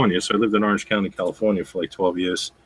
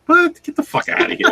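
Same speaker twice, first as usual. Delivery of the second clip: high-pitched startled brush-off; retold incredulity, pitched way up; mock disbelief; 3 dB louder than usual. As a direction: squeak out a comic refusal. Disbelief at autograph request.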